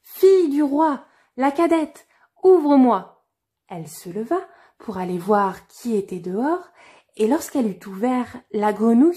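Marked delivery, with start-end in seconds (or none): 0.0-3.2 high, loud call through the door. A narrator's reading. Frog at the door calling the princess, loud high call. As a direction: call her to open up. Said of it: "on frappa à la porte et on appela".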